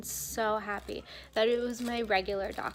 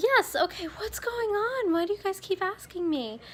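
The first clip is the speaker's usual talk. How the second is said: exasperated reply; 10 semitones higher than usual. Retold or imitated. Retelling annoyed reply to repeated question.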